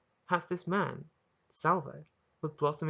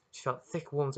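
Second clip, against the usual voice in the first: ordinary delivery, nothing marked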